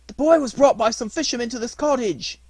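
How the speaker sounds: put-on high, loud old man voice; storybook old man, loud; old man informing. A narrator's reading.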